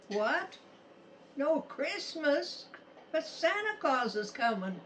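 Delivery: put-on disbelieving child's cry, rising high; shocked kid protesting; surprised. Kids hear Christmas is cancelled.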